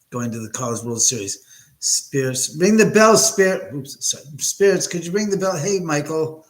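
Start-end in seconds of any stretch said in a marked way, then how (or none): 1.8-3.7 loud, high call to the spirits. Real, not acted. Ghost hunter calling loudly for spirits to ring the bell. 4.4-6.5 calling out. Real, not acted. Calling out to the spirits.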